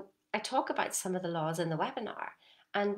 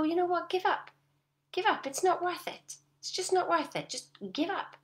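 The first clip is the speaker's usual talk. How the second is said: mock giving up; 9 semitones higher than usual. Shown as a demonstration. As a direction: toss it away, over it. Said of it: "just go".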